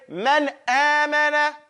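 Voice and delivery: man amana said slowly with the n sounded; noon pronounced clearly. Shown as a demonstration. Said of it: "make sure you pronounce that noon".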